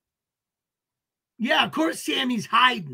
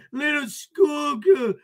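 higher, slow, hammed-up fake sob; mock crying; 4 semitones higher, 3 dB louder, slower than usual. Streamer taunting troll as a schoolgirl, mock blubbering. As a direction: whimper it like a mocked crybaby.

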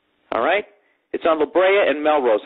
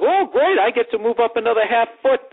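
high-pitched fake-dopey delight; mock dumb enthusiasm; 8 semitones higher than usual. Comic mocking himself in the hot dog line, dopey delight. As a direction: play your own dumb self, pitched way up. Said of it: "my dumb ass was like".

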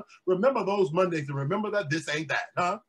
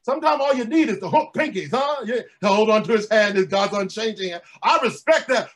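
high, loud preaching climb; full-force preaching; 4 semitones higher, 7 dB louder than usual. Acted. Pastor rousing the congregation, loud and high.

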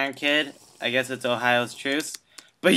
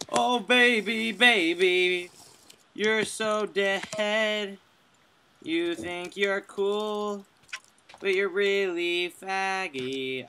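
slow, higher mocking sing-song; mocking taunt; 5 semitones higher, 3 dB quieter, slower than usual. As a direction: drag it out teasingly. Taunting opponent before kill.

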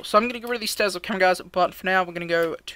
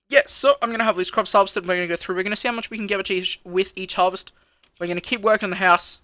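energetic outro; 7 dB louder than usual. Acted. Outro plans to viewers.

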